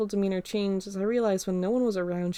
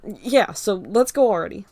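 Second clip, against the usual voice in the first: huffy; 9 dB louder than usual. A narrator's reading. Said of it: "I say, huffing softly".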